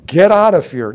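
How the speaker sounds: loud high shout of command. Acted. Jesus casting out the spirit with a commanding shout. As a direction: drive the spirit out. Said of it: "He shows them who he is".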